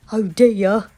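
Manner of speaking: a flustered fox fussing; fretful fox. Acted. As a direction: fox fretting aloud. Fox voice, loud and raised.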